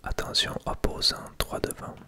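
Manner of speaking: ordinary talk, nothing marked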